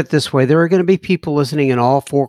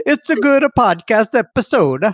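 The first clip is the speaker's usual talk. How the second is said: bad Italian accent; 6 semitones higher than usual. Joking in mock Italian accent.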